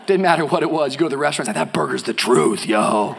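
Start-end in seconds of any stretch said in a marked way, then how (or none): 1.7-3.2 low, cool-guy mock approval; friend's catchphrase. Preacher imitating a friend at a restaurant, low and cool. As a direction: say it low, like a laid-back pal. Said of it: "used to have this friend".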